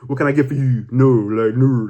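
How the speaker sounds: put-on rough stab at a Baltimore accent; half-botched Baltimore bartender accent; attempted Baltimore accent. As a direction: try a Baltimore accent and fumble it. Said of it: "like, Baltimore accent".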